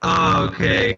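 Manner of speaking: distorted robotic call audio; glitchy voice over a bad connection. Real, not acted. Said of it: "You, you kind of sound like a robot".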